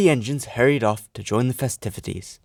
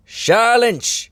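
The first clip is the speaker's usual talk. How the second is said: high, sharp command for silence; booming; 13 semitones higher, 3 dB louder than usual. Acted. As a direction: silence the grumbling engines. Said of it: "boomed the Fat Controller".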